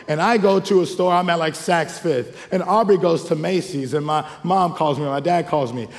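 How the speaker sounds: ordinary delivery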